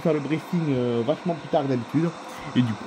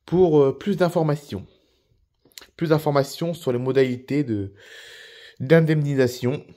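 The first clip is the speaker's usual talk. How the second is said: cabin announcement. Acted. Flight attendant addressing passengers before landing, formal PA read. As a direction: announce the landing like a crew member.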